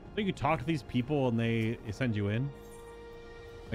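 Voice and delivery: ordinary delivery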